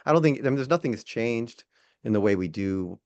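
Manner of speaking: ordinary talk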